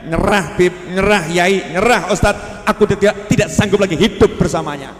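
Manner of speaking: loud pleading cry of giving up; mock desperate shout; imitating desperate wife. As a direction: wail it like a wife who has had enough. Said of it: "dengan mengatakan".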